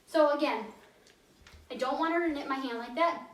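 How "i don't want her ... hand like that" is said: ordinary talk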